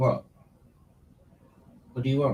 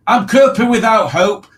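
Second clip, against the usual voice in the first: excited; 10 semitones higher, 9 dB louder than usual. Real, not acted. Reading chat aloud excitedly.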